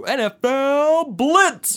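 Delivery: high, loud, slow game intro shout; announcer voice. Retold or imitated. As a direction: boom it out like a title screen. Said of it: "it would go".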